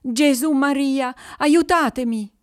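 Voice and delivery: loud, high cry for help; terrified cry. A narrator's reading. Shepherd clutching a cross, crying to Jesus and Mary for help, loud terrified cry. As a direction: shout to heaven in terror. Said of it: "gridò".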